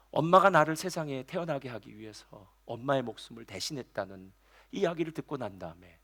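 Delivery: ordinary delivery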